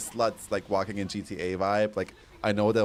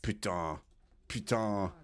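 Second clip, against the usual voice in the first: softer French swear word; French accent; 7 dB quieter, slower than usual. Shown as a demonstration. Streamer sharing a favorite French word, quiet French accent. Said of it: "My favorite French word".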